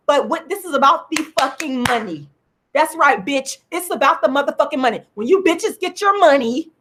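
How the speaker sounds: ordinary talk, nothing marked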